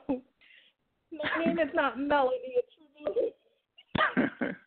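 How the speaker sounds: high, very slow, drawn-out protest; upset retort. Retold or imitated. Upset reporter correcting the director, retold high indignant protest. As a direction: stretch each word in high indignation. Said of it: "I'm upset. I go".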